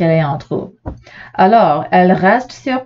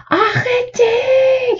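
high, long cry of stop; cry as loud as she can; much slower than usual. A narrator's reading. Catherine crie de toutes ses forces contre la dame, aigu et long. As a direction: give it all she has, shrill and drawn out. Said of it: "crie Catherine aussi fort qu'elle le peut".

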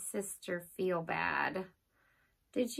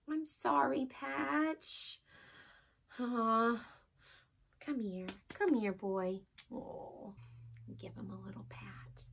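much softer, tender; gentle soothing voice; soft apology; 3 semitones lower, 11 dB quieter than usual. Acted. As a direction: make up with the dog. Regret, soothing the dog.

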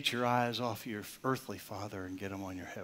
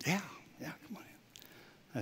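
whispered, slowing down; a child's sleepy whisper from bed; soft whisper; 7 semitones higher, 8 dB quieter than usual. Retold or imitated. Son answering softly from bed.

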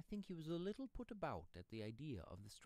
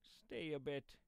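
higher-pitched, tentative plea; hesitating pedlar; 5 semitones higher, 4 dB louder, slower than usual. Acted. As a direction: hold him back, unsure of yourself. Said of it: "in a hesitating voice".

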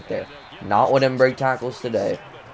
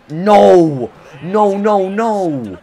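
loud, high cries of no; anguished no; 10 semitones higher, 13 dB louder, much slower than usual. Real, not acted. Gamer yelling at the screen as the pass fails, loud despair. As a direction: shout it as the play falls apart. Watching a play fail.

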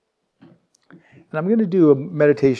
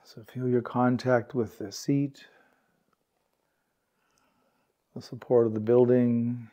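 calm, unhurried meditation guide, words spaced by long pauses; guided meditation; 2 semitones lower, 5 dB quieter than usual. Real, not acted. Meditation teacher guiding warm-hearted peers to relax, calm and unhurried. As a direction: gently lead a sitting group into stillness, leaving space.

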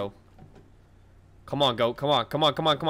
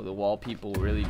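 ordinary talk

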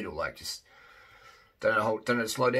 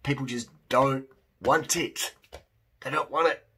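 ordinary talk

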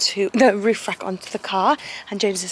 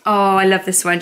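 usual speaking voice

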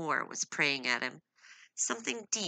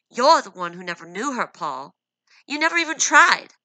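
louder and louder, she lets him have it; angry outburst; 6 semitones higher, 14 dB louder than usual. Acted. Wife shouting at her husband in defense of her friend, building fury. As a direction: shout his own accusation back at him.

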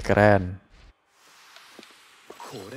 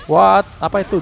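surprised what; 5 semitones higher than usual. Real, not acted. Enemy uses unknown move.

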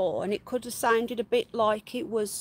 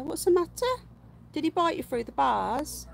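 normal talking voice

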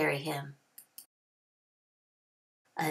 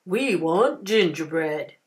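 slow, louder lowing voice; cattle voices; 6 dB louder than usual. A narrator's reading. Longhorn cattle tired of grass, slow lowing voice. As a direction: moo the words like hungry cattle. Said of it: "they cried".

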